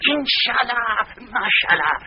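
high, drawn-out pious exclamation; effusive vizier. Acted. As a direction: sing out a flowery farewell. Vizier's flowery farewell.

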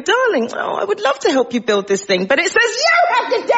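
high, sweet excuse climbing to a squeal; mock doting partner. Woman to partner building a cupboard, mock-regretful cooing. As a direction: coo regretfully, then shoot up high on the punchline.

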